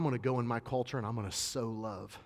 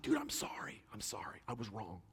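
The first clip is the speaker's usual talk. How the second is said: whispered apology; 8 dB quieter than usual. Retold or imitated. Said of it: "And I was like".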